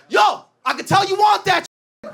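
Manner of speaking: a high cocky shout from behind. Retold or imitated. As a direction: call it across the store, cocky. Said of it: "All I hear is".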